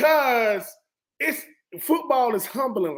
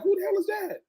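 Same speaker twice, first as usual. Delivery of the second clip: shocked who's that; 11 semitones higher than usual. Retold or imitated. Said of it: "I'm like".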